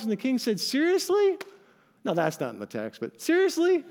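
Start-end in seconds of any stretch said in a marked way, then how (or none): 0.6-1.4 high-pitched, acted disbelief; incredulous. King hearing of the servant's unforgiveness, incredulous. 3.2-3.8 high-pitched, acted disbelief; incredulous. King hearing of the servant's unforgiveness, incredulous. King's reaction repeated for laughs.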